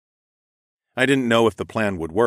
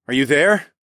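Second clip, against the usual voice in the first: louder searching call; 5 semitones higher, 4 dB louder than usual. A narrator's reading. Searching the meadow for Amaya, calling louder. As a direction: call out into the open.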